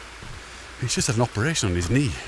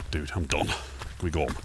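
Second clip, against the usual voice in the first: low, drained, fed-up mutter; a hint of a worn-out dog; tired dog voice; 5 semitones lower, 6 dB quieter, faster than usual. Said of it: "she would have been like".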